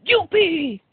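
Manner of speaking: high, loud whoop of delight; triumphant little whoop; gleeful shout. A narrator's reading. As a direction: cheer like an overjoyed king. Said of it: "gritó el rey".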